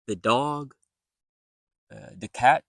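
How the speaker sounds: "the dog, the cat" with a d-like th; everyday "the". Shown as a demonstration. Teacher showing what most people say, relaxed TH. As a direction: keep the tongue in for "the" before the noun. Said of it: "most people will just say".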